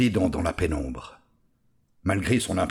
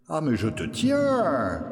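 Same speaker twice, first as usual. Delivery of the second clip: Robert triomphant, plus aigu et lent; il brandit sa prise, ravi; triomphe; 6 semitones higher, slower than usual. Acted. Robert attrape la souris. (le forgeron attrape la souris, triomphant)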